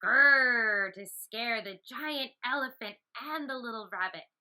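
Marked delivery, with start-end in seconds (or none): 0.0-0.9 lion roar. Acted.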